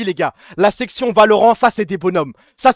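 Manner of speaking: usual speaking voice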